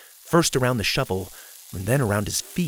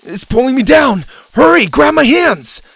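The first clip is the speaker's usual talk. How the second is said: loud, high-pitched urgent yell; panicked shout; 12 semitones higher, 10 dB louder than usual. A narrator's reading. Boy sinking in dirt yells to friend, panicked. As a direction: cry out in panic for rescue. Boy being sucked down, begging help.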